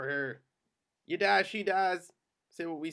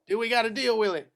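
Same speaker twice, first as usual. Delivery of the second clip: loud, higher insistent question; forceful, raised-pitch demand; pressing for answer; 6 semitones higher, 6 dB louder than usual. Acted.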